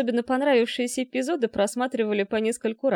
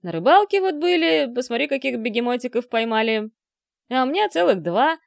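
proud, louder bragging opening; boasting; 2 semitones higher, 5 dB louder than usual. A narrator's reading. Men back from fishing show off their catch, boastfully. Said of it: "похвастался Хали".

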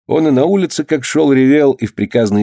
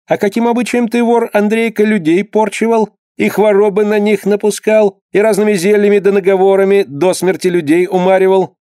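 stern interrogator; 8 semitones higher than usual. Acted. Clerk interrogating the healer.

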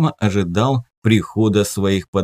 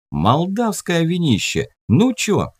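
put-on high, slow cocky young voice; cheeky teen; 7 semitones higher than usual. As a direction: show off the wine, grinning. Teen showing off cheap wine.

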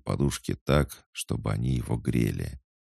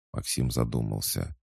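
usual speaking voice

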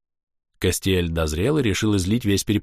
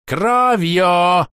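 higher, louder furious shout; 11 semitones higher, 8 dB louder than usual. Acted. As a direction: scream the word out. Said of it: "возопил Кастиэль".

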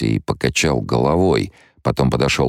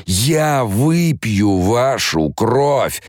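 high, very slow, otherworldly snarl; possessed demonic voice; 10 semitones higher, much slower than usual. Acted. Possessed girl threatening the guards, slow and eerie. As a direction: drag each word out like something inhuman.

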